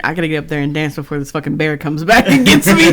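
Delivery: loud joking panic breaking into laughter; mock panic. Joking as the kid scared off by him, loud laughing mock panic. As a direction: blurt it out loudly, cracking up.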